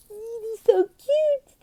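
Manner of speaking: very high squealing, slow; cutesy squeal. Shown as a demonstration. Tutor squeals over cute little lizards. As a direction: gush over tiny lizards. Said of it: "I'm like".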